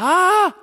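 high, loud pretend fright; mock startled yelp. Dad pretends the dinosaur magnet scared him, mock startled yelp. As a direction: yelp in playful alarm. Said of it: "I'm like".

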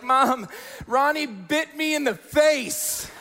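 high, slow mock wail; tattling child. Bitten brother tells mom, hammed-up tattling. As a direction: imitate a little brother telling on you.

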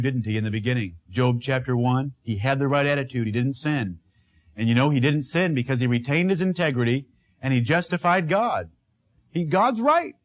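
usual speaking voice

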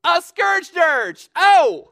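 high, very loud, drawn-out outburst; exuberant shout. Real, not acted. Preacher answering his own riddle, exuberant shout. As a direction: belt out the silly phrase like a triumphant punchline. Blurts out a pun in delight.

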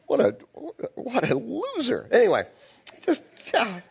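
ordinary talk, nothing marked

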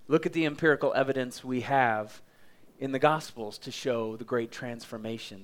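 normal talking voice

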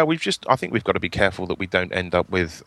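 ordinary talk, nothing marked

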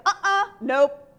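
playfully digging in her heels; a defiant little laugh of a refusal; stubborn refusal. Retold or imitated. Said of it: "And I said".

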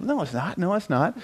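high, mock-defensive denial; quick self-mocking retort of denial. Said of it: "I got all defensive".

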